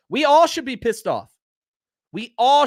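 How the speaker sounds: rally the fans with real anger; angry outburst. Real, not acted. Angry fan rallying viewers.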